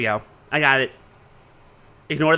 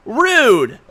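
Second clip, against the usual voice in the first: mock offended; 7 semitones higher, 7 dB louder than usual. Echoing character's catchphrase.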